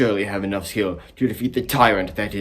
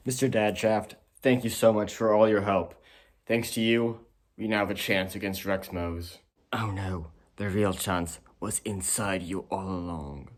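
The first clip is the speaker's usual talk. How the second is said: ordinary talk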